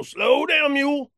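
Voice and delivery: put-on high, drawling old cowpoke. Drunk cowpoke slowing his mule, high and drawling.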